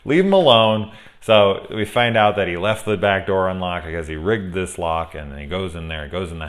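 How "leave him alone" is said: louder, higher, exasperated outburst; angry. Real, not acted. Film fan ranting in defence of the hero, angry. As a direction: blurt it out loud, fed up. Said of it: "because I'm angry".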